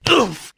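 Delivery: high, loud grunt as the air is knocked out; winded oof. A narrator's reading. Archie, crashing to the ground, grunts winded. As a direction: hit the ground hard, breath gone. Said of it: "he cried".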